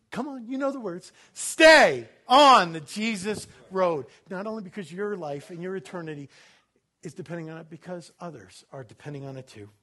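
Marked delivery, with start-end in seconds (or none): 1.3-4.0 each word drawn out slowly and punched; preacher leading the congregation in a slow, emphatic chant; slow call-and-response. Shown as a demonstration. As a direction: lead the crowd word by word, slowly. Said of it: "Say it with me".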